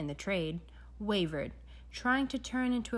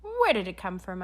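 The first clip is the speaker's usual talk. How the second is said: sharp interruption; 7 semitones higher, 3 dB louder than usual. A narrator's reading. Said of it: "I interrupted".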